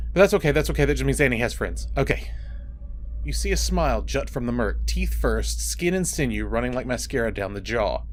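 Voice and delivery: usual speaking voice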